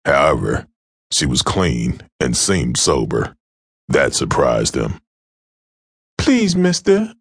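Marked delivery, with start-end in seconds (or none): 6.2-7.1 high, pleading whimper; frightened girl whimpers to her attacker; whimpering young woman. Acted. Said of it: "she whimpered".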